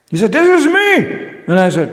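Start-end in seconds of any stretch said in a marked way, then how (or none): 0.3-0.9 high, loud mock-offended cry; the cop insisting it is really him; exasperated insistence. Policeman insists on photo.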